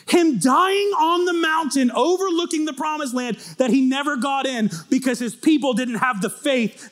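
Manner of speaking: high, shouted sermon delivery; full-force preaching. Acted. Preacher rousing the congregation at full voice. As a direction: preach it to the back row.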